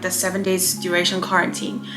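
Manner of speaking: normal talking voice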